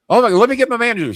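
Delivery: high, excited gasp of recognition; a mock-awed hotel clerk; starstruck clerk. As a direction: play the dazzled clerk hearing the name.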